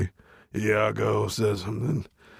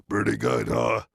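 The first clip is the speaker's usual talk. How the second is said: ordinary talk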